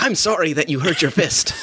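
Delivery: mock-polite Canadian that comes out Irish; botched Canadian accent. Jokingly voicing apologetic Canadians, botched Irish-sounding accent. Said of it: "I did an Irish accent".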